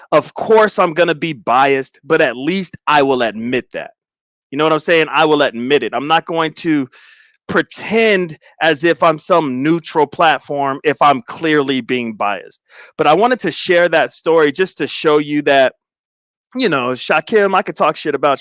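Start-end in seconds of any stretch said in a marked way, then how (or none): none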